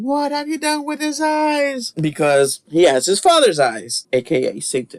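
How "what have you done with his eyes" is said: mock horrified cry. Mother seeing the baby's eyes in the retold film, mock horrified. Acts out the infamous horrified line.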